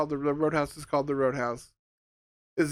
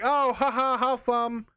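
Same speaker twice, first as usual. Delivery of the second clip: high, bright mock-cheery laugh it off; mock cheerful; 7 semitones higher than usual. Reviewer imagining future viewers, mock cheerful and high. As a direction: play it like a harmless fun flop, pitched up. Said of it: "we can just be like".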